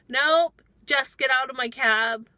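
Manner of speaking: 훨씬 높고 크게 내지르는 "Nope"; 축복을 잘라내며 소리쳐 내쫓기; angry shout. Retold or imitated. Rejecting passenger's blessing.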